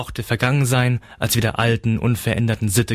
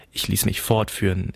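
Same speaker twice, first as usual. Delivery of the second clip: ordinary delivery, nothing marked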